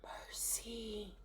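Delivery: breathy whisper of mercy; woman whispering in shock; whispered oath. Acted. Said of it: "one woman swore".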